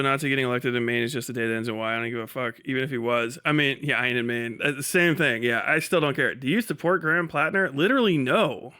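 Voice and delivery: usual speaking voice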